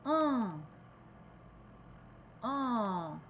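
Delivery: "En" nasal with the mouth open vertically; teacher demonstrating nasal "En"; nasal vowel. Shown as a demonstration. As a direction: sound "En" through the nose. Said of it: "avec la bouche verticale".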